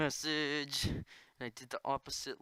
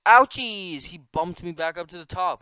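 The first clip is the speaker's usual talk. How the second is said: loud annoyed yelp; loud outburst; 5 semitones higher, 15 dB louder than usual. Real, not acted. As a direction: yelp in frustration. Loud pained exclamation.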